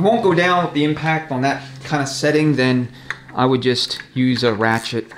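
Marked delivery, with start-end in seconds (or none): none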